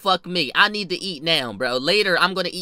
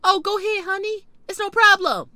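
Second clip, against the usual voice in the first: high-pitched imitation of her; mock her in a high, honeyed voice; put-on high lady voice; sweetly fake woman; 13 semitones higher than usual. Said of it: "Y'all know what she says?".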